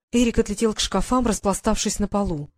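ordinary delivery, nothing marked

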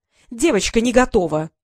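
ordinary delivery

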